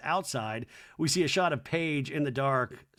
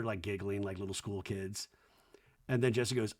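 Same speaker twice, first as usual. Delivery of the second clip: ordinary delivery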